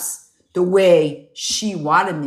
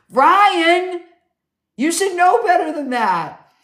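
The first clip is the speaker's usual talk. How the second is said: playful scolding; 9 semitones higher, 3 dB louder than usual. Real, not acted.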